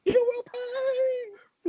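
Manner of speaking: Paul Bearer voice. Retold or imitated. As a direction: stretch each word in a high cry. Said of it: "you gotta do the voice".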